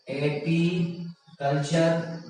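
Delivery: Apiculture very slowly, in separated parts; drawn out. Shown as a demonstration. Teacher reading out option A for students, drawn out. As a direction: break apiculture up slowly with a gap inside.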